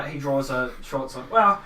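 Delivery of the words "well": high, loud stammered threat; nervous farmer standing his ground; flustered farmer warning. Acted. Farmer draws sword, warns stranger.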